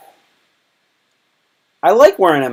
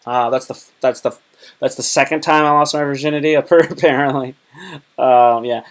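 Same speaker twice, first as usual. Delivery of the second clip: usual speaking voice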